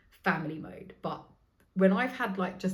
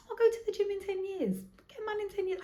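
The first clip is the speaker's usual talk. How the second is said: much higher, breezy and dismissive; carefree shrug. Retold or imitated. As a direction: toss it off like it doesn't matter.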